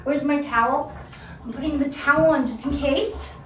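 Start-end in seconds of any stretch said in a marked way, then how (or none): none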